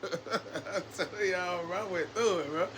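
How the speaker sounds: real laughter while telling the story; laughing. Real, not acted. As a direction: chuckle it out under your breath. Laughing after the retold beating.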